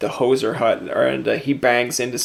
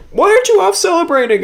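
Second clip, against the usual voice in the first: squeaky, much higher, louder puzzled voice; puzzled goalie; 5 dB louder than usual. Retold or imitated. Goalie asking the scorer why he isn't celebrating, high-pitched and baffled.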